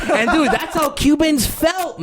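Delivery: slow, high and laughing. Real, not acted. Laughing punchline.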